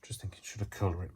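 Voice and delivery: fast whisper to herself; whispered thought. Real, not acted. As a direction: whisper it like a private aside. Thinking aloud while crafting.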